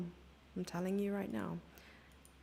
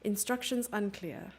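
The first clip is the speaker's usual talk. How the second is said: ordinary talk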